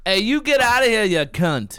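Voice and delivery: high-pitched Aussie telling-off; playful angry Australian shout; mock Australian yell. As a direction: shoo someone off in hammed-up Australian.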